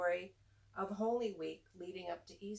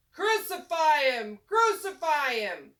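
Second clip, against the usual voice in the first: high, much louder angry yell; crowd yelling; 6 semitones higher, 14 dB louder, slower than usual. A narrator's reading. Teacher voicing the mob demanding Jesus be crucified, a loud angry yell. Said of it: "And the crowd yelled".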